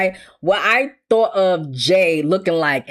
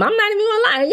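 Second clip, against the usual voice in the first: ordinary delivery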